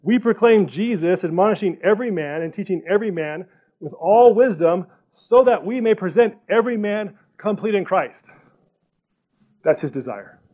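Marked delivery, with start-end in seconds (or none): 0.0-8.3 loud, emphatic read of scripture. Acted. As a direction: proclaim the verse to the room. Reads verse with emphasis.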